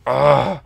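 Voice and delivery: loud, acted-out "ugh"; mock exasperated groan. Skier caught between dad and angry boyfriend, exasperated groan. Groan at being challenged to fight.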